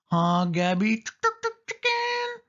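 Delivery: very high, squawking chicken chant; chicken clucking taunt. A narrator's reading. Sari mocks her scared cousin, clucking like a hen. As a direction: cluck it like a hen to mock him. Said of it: "she repeated".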